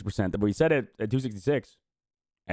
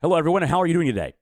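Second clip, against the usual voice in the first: speed reading; 7 semitones higher, 4 dB louder than usual. Shown as a demonstration. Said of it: "Let's see how fast we can do it".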